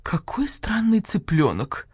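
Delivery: surprised whisper; hushed, slow wondering whisper; шёпот. Acted. Удивлённая хозяйка разглядывает птицу. (удивлённый шёпот)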